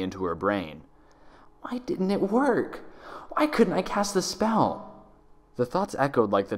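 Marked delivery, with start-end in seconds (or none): 1.6-4.8 high, slow, anguished questions; desperate Twilight. Acted. Trapped Twilight asking herself, high and anguished. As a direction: she can't understand why it failed.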